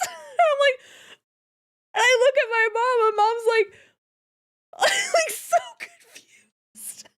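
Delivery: high squeaky laughter through words; barely getting it out for laughing; real giggles taking over; laughing hard. Real, not acted.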